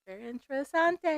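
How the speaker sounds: mock Spanish. Mock Spanish aside on theory.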